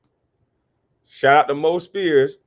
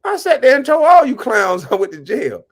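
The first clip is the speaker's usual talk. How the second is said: loud retort; 8 semitones higher, 6 dB louder than usual. Real, not acted. Retorting to trolls.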